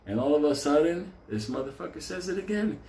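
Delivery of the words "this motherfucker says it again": usual speaking voice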